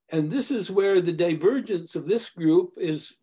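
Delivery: normal talking voice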